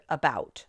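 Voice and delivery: "about" with a muffled first syllable, BOUT stressed; unstressed "a", punched "bout" in "about". Shown as a demonstration. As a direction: say "about" naturally, weak uh then strong bout. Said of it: "when we say the word".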